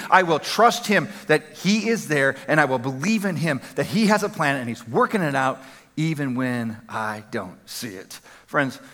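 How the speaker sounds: soft closing prayer. Real, not acted. Preacher closing the sermon to his congregation, soft and slowing. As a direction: ease down into a hushed close.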